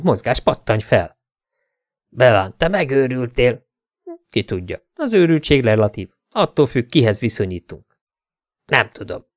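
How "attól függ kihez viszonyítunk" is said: usual speaking voice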